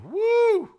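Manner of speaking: loud, very high "woo"; piercingly high whoop of amazement. Real, not acted. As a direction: let out a high, ringing whoop. Reacting to LSU's low ranking.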